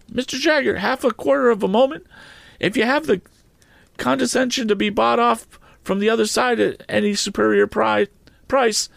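high, frantic pleading voice; high wheedling petitioner; excitable client. A narrator's reading. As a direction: beg him in a high rushed voice.

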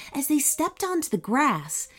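ordinary delivery

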